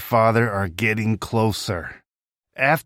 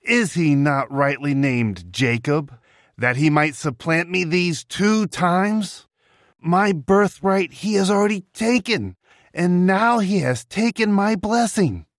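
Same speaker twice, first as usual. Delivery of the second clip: higher, rising indignation; aggrieved Esau; 8 semitones higher than usual. Acted. Esau complains of Jacob.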